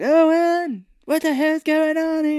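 put-on very high, loud old-mother holler; hammed-up screech for her son; mock Mama yelling. Imitating Mama waking up to cops, mock yelling. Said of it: "She's yelling 'cause she's like".

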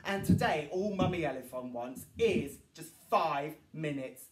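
plain reading voice

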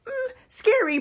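mock scared. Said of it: "we don't really get fazed".

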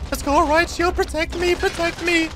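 high, squeaky begging for cover; mock pleading. Gamer begging Right Shield teammate for protection, high mock plea. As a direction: play the helpless one hiding behind the shield.

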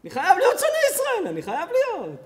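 mock zealot voice, sped up; mocking envious neighbour. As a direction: rattle off the neighbour's spite in parody. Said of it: "ואתה אומר".

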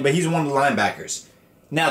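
usual speaking voice

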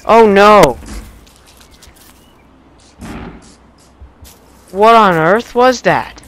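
loud, high panicked cries; panicked shouting. Real, not acted. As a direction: shout in real fright. Attacked by creatures.

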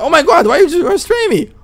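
very high panicked outrage. Acted. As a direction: shriek in disbelief at arrest. Being arrested in game.

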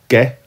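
ge said as a hard "geh", as in get; hard g sound. Shown as a demonstration. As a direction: stretch out ge slowly with a hard g. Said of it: "to keep that ge sound".

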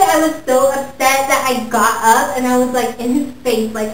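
ordinary talk, nothing marked

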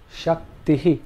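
shaktihi ending in a breathy echo hi; shaktihi, with the final i echoed after a puff of breath; visarga example. Shown as a demonstration. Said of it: "Some examples to illustrate this".

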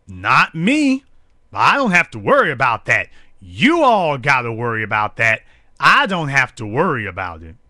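lightly mock him bragging he's safe; a hint of a gloating insider; smug mock Ray Lewis. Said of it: "That's when he was letting you know".

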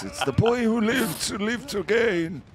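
high, put-on dark lord voice; Voldemort. Commentator copies his partner's joke, in a high villain voice. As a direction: do an eerie, high-pitched villain. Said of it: "in a Voldemort voice".

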